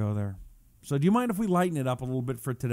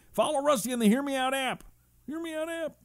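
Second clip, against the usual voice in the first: silly high plug; 12 semitones higher, 4 dB quieter than usual. Pretend. Playful plug, squeaky voice.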